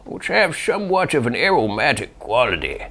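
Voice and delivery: lightly voiced old man. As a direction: read it as an elderly man. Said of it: "He's requesting an old man voice".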